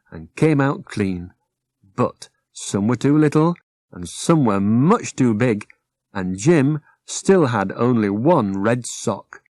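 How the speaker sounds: plain reading voice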